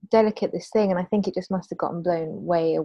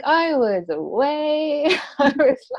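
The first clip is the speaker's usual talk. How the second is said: giggling through the words, drawn out; laughing; 7 semitones higher, 4 dB louder, slower than usual. Retold or imitated. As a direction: laugh while saying it slowly. Laughing retelling own spaced-out moment.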